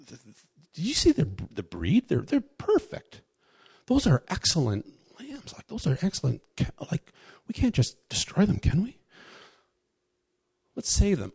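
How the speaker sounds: high, hushed, scheming Saul; preacher playing Saul sneaking off with the best lambs; greedy Saul. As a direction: whisper the temptation like a greedy king eyeing prize lambs. Preacher voices Saul coveting lambs.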